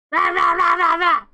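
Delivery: loud monster roar; gremlin roar. Acted. Gremlin leaping out at Billy, loud roar. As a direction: roar to scare the kid.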